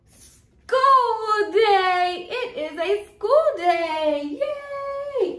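loud, high, excited announcement. Acted. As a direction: announce it with big joy. Announcing to kids.

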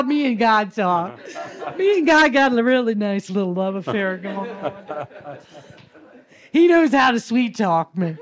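real laughter bubbling through the words; laughing. Real, not acted. Joking to listeners about her and God's love affair, laughing.

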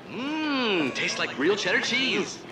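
mmm, savoring ad read, slow; ad line delivery. Shown as a demonstration. Host coaching the girl to read the ad line. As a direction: sell it like a cheese commercial. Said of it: "look in the camera and say".

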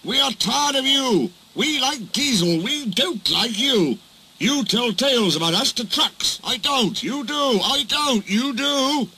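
high-pitched, insistent accusing back-and-forth; heated squabble. Acted. Engines accuse Duck of telling tales, heated squabble. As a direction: bicker heatedly, each retort pitched high.

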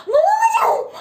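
high-pitched cry of disbelief; excited disbelief. Real, not acted. Streamer watching viewer numbers climb, excited disbelief. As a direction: cry it out, astonished.

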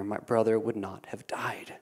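whispered, breaking off; hushed grief. Acted. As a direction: breathe it out like a grieving sister. Mary's grieving words at his feet.

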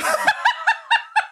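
shrill squeal of laughter; high squealing laugh. Real, not acted.

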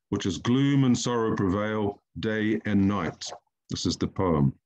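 ordinary talk

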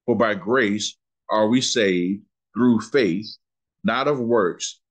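very slow, each word spaced out; slow it right down so it sinks in; unhurried scripture line, word by word. Shown as a demonstration. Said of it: "I'm gonna say it slow".

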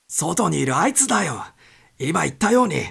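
loud agitated insisting; insistent. Acted. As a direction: press the captain hard. Wilson insists loudly.

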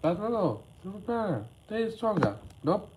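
a hint of a panicking little minion; squeaky minion voice.

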